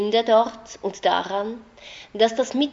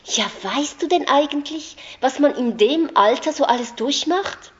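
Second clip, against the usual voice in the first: indignant retort; 7 semitones higher, 5 dB louder than usual. A narrator's reading. Anna retorts in argument.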